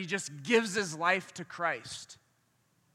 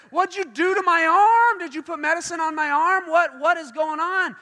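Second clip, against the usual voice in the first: loud, high, frantic acted yelling; freaking out; 8 semitones higher, 9 dB louder than usual. Preacher acts out healed man yelling about his arm, freaking out. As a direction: shout in wild disbelief at what's happened. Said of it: "he starts freaking out".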